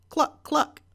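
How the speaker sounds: high, loud hen cluck. A narrator's reading.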